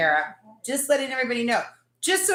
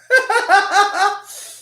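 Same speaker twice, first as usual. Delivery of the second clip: burst of laughter; 8 semitones higher, 8 dB louder than usual. Real, not acted. Laughing at own request.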